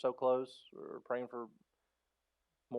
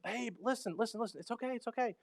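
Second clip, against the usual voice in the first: higher, gentle soothing; consoling; 10 semitones higher than usual. Retold or imitated. Father consoling his hungry daughter, high and soothing. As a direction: soothe an upset toddler. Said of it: "I'm, like, consoling her".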